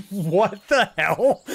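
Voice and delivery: slow, loud, laughing shock; laughing disbelief. Real, not acted. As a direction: stretch it out through a laugh. Laughing surprise at sudden donation.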